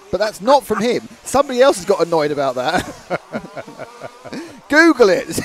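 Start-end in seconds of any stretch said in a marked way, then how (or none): 4.7-5.4 loud, high mock command; hammed-up angry order; mock aggressive. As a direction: imitate an annoyed chat message. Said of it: "It's got very aggressive".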